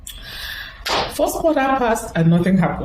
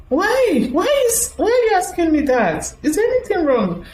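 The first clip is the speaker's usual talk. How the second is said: higher, bewildered questions; puzzled surprise; 12 semitones higher than usual. Retold or imitated. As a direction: act confused, pitch up. Her own puzzled reply retold.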